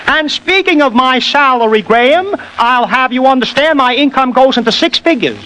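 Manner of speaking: high, peppy tenor. Shown as a demonstration. Mimic jokes to the host about his salary, in a high tenor. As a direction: pitch it well up, rising and falling. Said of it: "take [name] again".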